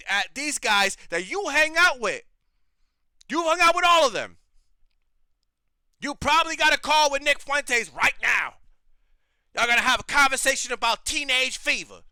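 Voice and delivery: loud, high-pitched accusing rant; heated confrontation. Real, not acted. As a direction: raise your voice and call them out.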